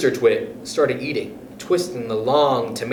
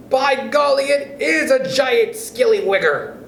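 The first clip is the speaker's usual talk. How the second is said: Mr Twit mock alarm; 10 semitones higher than usual. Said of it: "said Mister Twit".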